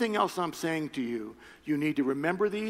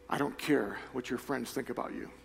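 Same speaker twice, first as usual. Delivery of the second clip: intimate preaching; 5 semitones lower than usual. Acted. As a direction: speak to every heart gently. Preaching God's message softly.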